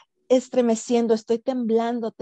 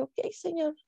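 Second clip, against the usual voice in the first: soft, hushed yielding to God; quiet surrender; 5 semitones higher, 7 dB quieter than usual. Retold or imitated. Widow yielding to God's will, softly resigned. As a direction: give in quietly, barely voiced. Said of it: "y me callé".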